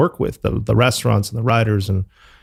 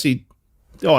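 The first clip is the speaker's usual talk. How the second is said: normal talking voice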